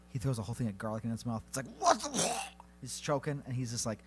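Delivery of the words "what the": startled vampire. Retold or imitated. Vampire choking on garlic.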